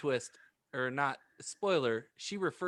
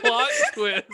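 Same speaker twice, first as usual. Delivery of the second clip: laughing squeal; 11 dB louder than usual. Real, not acted. Laughing outburst.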